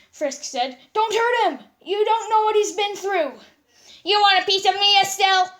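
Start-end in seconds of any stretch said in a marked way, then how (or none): 0.9-3.4 high, loud plea to spare him; pleading shout. Acted. Frisk pleading to stop Garnet. 4.0-5.5 high, loud taunting challenge; angry taunt. Acted. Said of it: "his angry, evil face on".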